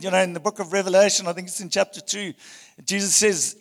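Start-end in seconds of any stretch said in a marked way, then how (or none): none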